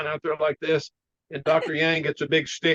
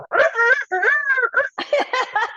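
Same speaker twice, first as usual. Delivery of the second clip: high mock rooster crow through laughter; imitating the rooster crowing at dawn.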